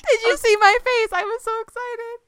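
very high squeal on the edge of laughter; high-pitched giggly excitement; helpless amusement. Real, not acted. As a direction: squeal it out, cracking up. Host cracking up over taco story.

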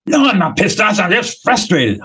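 high, indignant denial; defensive speaker. Acted. Claire's speaker pushes back, indignant and high-pitched. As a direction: deny it hotly, like a frustrated client. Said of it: "I'll just do it".

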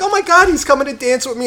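high, breathless mock swoon; mock girly voice. Girls at the bar, mock giddy high voice. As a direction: ham up a giddy girl.

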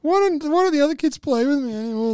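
put-on whiny child voice. Mocking Ted as lonely child.

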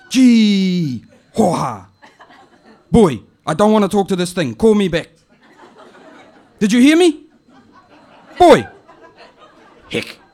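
put-on high, loud calling out to the boy; an old auntie hollering at an answer phone; high loud auntie voice shouting for her nephew. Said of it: "it goes like this".

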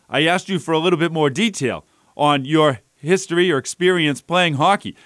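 ordinary talk

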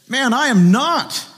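high, loud, drawn-out protest; emphatic denial. Acted. Peter denying his accuser, emphatic high protest.

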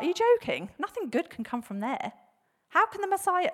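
acted incredulous protest, rising high; shock. Nathanael doubting anything good comes from there, shocked. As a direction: play up the disbelief. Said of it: "this shock value of, like".

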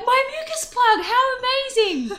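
overjoyed exclamation with a laugh; thrilled. Retold or imitated. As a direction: squeal it out in giddy triumph. Thrilled shout over mucus plug.

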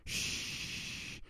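sharp unvoiced shush. Alf shushing Willie by the couch, unvoiced. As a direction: hush him urgently.

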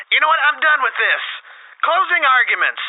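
usual speaking voice

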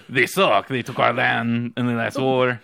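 hammed-up gloating nation; mocking France. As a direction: cackle at the rival like a smug France. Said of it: "And France was like".

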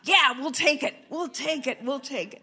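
eager, happy yes; excited. Retold or imitated. Happy reaction to headline.

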